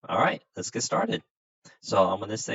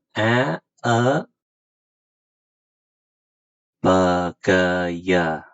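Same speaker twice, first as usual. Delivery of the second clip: teacher voice; 6 dB louder than usual. Acted. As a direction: clear classroom teacher leading a lesson. Teacher leads Vietnamese alphabet lesson.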